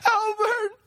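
very high, sobbing call; grief-stricken crying shout; tearful scream. A narrator's reading. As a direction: wail the name through tears. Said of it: "she shouted between tear-filled sobs".

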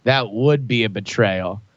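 low, slow mock sincerity; solemn concession. [name] pretends to agree with Brian, solemn. Fake agreement before shooting partner.

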